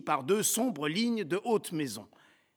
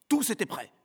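plain reading voice

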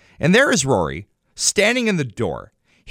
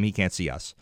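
ordinary talk, nothing marked

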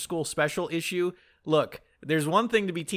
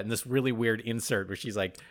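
usual speaking voice

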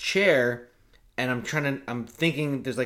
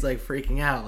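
ordinary talk, nothing marked

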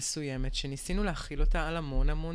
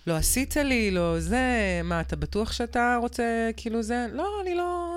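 higher, louder wounded complaint; guilt-tripping whine; 6 semitones higher, 5 dB louder than usual. Retold or imitated. Mocking emotional manipulation in a relationship, wounded whiny complaint. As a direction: lay on the guilt, half-mocking. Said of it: "כזה".

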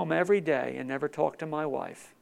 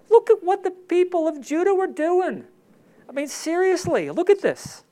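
much higher and louder, preaching hard; fired-up exhortation; 11 semitones higher, 7 dB louder than usual. Real, not acted. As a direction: blast it at the congregation. Preacher erupts at listeners.

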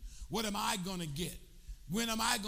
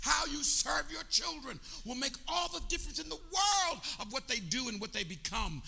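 preaching; 5 semitones higher, 5 dB louder than usual. Real, not acted. Preaching at full force.

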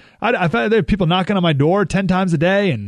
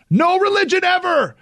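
mock exaggeration. Host exaggerating the church-and-state claim, mock proclamation. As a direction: blow it up into an absurd absolute.

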